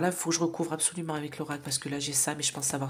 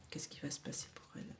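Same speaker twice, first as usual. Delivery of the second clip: near-silent unvoiced whisper to the cards; whispered question; 20 dB quieter than usual. Real, not acted. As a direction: ask the oracle under your breath. (question chuchotée à l'oracle)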